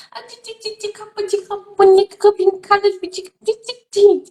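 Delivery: put-on high childish babble; toddler babble. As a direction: imitate a small child stringing garbled syllables. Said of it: "aquela criança de quatro anos que tateia, fala".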